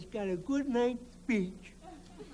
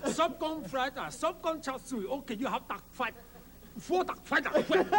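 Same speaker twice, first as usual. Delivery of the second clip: mock Chinese accent. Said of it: "the Chinese waiter".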